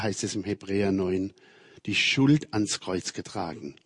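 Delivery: ordinary delivery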